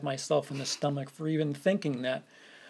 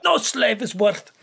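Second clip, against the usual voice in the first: louder, higher alien trader voice; Watto voice; 6 semitones higher, 7 dB louder than usual. Pretend. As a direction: bark it like a grumpy junk dealer. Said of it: "It's the same fucking voice".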